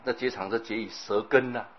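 ordinary talk

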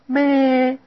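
a high mock goat "咩"; goat bleat. Shown as a demonstration. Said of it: "只好学羊叫啊".

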